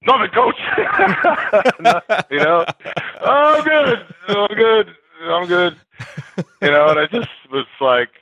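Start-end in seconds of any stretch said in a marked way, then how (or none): 0.0-5.8 high, squeaky, quick retreat; laughing, meek nothing coach; sheepish backing down. Retold or imitated. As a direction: play innocent after almost tackling him. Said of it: "And I'm like".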